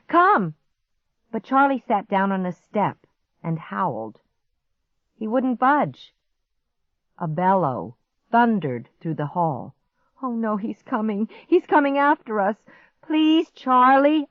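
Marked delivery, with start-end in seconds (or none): 0.1-0.6 high, loud sharp command; urgent plea. A narrator's reading. Girl urges dog onward, pleading loudly. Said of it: "I pleaded". 10.2-14.3 high, panicky plea building louder; frantic begging. A narrator's reading. Girl begs dog as monster comes, frantically. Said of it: "I begged".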